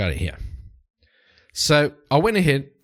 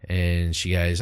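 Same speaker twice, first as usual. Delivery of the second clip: ordinary talk, nothing marked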